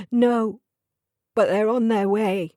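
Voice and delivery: sobbing. A narrator's reading. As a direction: push it out through a sob. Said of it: "A sob escaped her lips".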